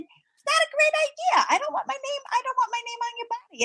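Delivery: high, fast mock protest; high-pitched mock plea; quoted self. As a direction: squeak it out high and quick. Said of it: "And I said".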